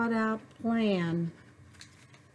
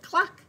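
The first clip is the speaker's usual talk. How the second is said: hen cluck; 10 semitones higher than usual. Acted. Hen sound in story.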